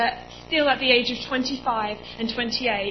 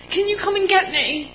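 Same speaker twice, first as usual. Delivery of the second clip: high, loud, tearful begging; panicking; 8 semitones higher, 6 dB louder than usual. Retold or imitated. Begging mum on the phone, tearful panic. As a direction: beg to be fetched, close to sobbing. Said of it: "I'm absolutely panicking".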